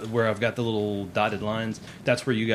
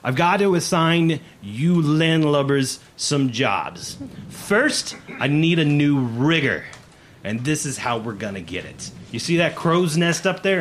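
loud, raised officer's voice handing out orders; commanding and loud, weighty on key words; exasperated first mate; 5 semitones higher, 5 dB louder than usual. Acted. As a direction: bark assignments at the new crew. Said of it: "rubbing his forehead as he goes".

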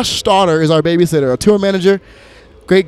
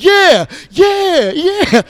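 much higher, loud egging-on yeahs; hyped agreement; 12 semitones higher, 5 dB louder, much slower than usual. Retold or imitated. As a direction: back up your friend like a hype man. Mac backing Prof up.

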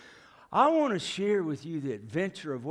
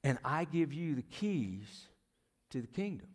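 slow, softer quoted promise; quoting Jesus slowly and softly; slow, soft scripture quote; 5 dB quieter than usual. Acted. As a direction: hand over the words gently and slowly. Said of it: "He says".

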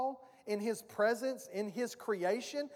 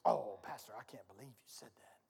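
much lower, hushed whisper of a shocked congregant; breathy low pretend shock, whispered; mock scandalized churchgoer; 12 semitones lower, 9 dB quieter than usual. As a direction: gasp it like a prim church lady caught off guard. Mock shocked churchgoer after joke.

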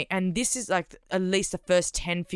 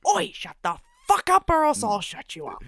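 retold threat; 4 semitones higher than usual. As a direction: snap it out like you mean it. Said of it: "And so I just went".

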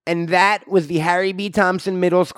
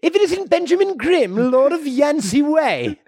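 mock British greeting; 7 semitones higher than usual. Greets Benjamin Grimm British style.

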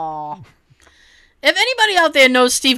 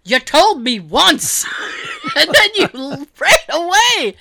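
slow, loud, higher protest with laughter; stretched-out mock complaint, breaking into laughter; mock exasperation; 7 semitones higher, 4 dB louder, slower than usual. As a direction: imitate a flustered cook pleading his case. Acted complaint of flustered cook.

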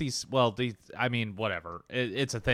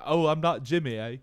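slightly raised mock British voice; badly faked British reply; 5 semitones higher than usual. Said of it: "I don't know how to talk like [name]".

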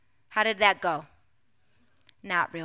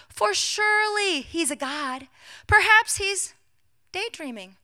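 high, drawn-out taunt that drops away; mocking scoff; 9 semitones higher, 4 dB louder than usual. Elijah mocking the prophets' god, scoffing. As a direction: scoff slowly, pitched up, then let it fall. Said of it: "he scoffed".

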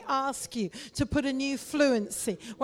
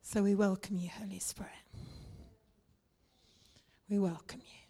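breathy whisper of welcome; 3 semitones lower, 6 dB quieter, faster than usual. Real, not acted. Pastor welcoming the Holy Spirit in a whisper. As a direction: whisper it, reverent and close.

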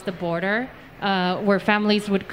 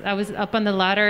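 usual speaking voice

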